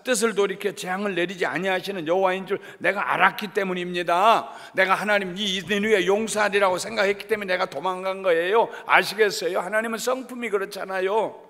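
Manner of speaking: loud, high-pitched acted outburst; indignant protest. Jonah justifying his flight to God, indignant shout. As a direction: argue back at full volume.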